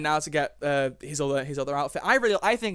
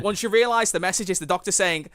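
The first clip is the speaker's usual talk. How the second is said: normal talking voice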